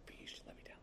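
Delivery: imitating a toddler's tiny whispered surrender; barely audible, voiceless whisper; whispered plea. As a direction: give in with a very faint whispered plea. Imitates toddler finally giving in.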